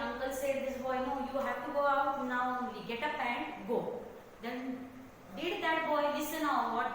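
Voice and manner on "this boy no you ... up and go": ordinary delivery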